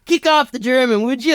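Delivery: high, strained-sounding order; urging cowboy father. Acted. Cowboy father hauling Michael up by lasso, high urgent call.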